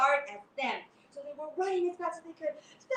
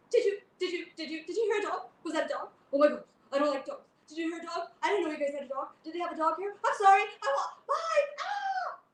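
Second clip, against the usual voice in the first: high loud flustered babble ending in a shriek; panicky cat; 4 semitones higher, 5 dB louder, faster than usual. Acted. Cat scared off by a dog, panicky babble. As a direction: babble in fright then shriek.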